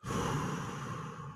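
deep audible breath out; long slow exhale. Real, not acted. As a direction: breathe out slowly and fully. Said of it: "I'm doing breath work".

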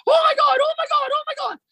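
high, loud retold panic; shrieking the wife's shock; alarmed exclamation. Wife hearing the ball fly, shrieking in fright. Wife's terrified reaction to baseball.

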